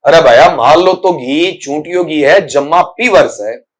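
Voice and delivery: mock rustic elder's talk; joking village elder. Teacher jokingly imitates local elders, in rustic style. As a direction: put on a local old-timer, slowing at the end. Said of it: "मजाक के अंदाज में".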